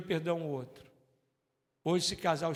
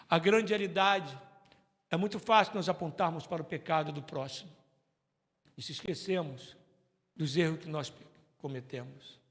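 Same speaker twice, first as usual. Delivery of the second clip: usual speaking voice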